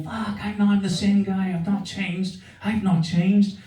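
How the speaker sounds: mock anguish to the sky; despairing plea. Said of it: "I'm going".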